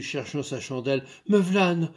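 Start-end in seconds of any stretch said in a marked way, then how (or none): 1.3-1.9 a touch of panic, called out; flustered. Acted. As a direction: stumble in a rush. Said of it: "éperdue".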